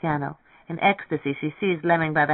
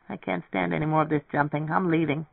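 ordinary delivery

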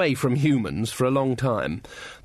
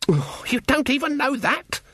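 high-pitched, incredulous scolding; indignant and exasperated; exasperated disbelief; 13 semitones higher than usual. Acted. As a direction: groan at the girl's ignorance, voice pitched right up. Granny exasperated at ignorant girl.

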